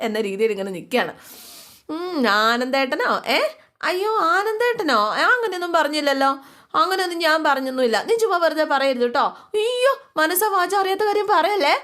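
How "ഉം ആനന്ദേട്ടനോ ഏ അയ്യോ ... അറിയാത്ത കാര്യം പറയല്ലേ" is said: put-on high, flustered voice of a girl caught daydreaming; flustered girl denying. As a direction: play a girl caught out, squeaking her denials. Devi caught, flustered denial.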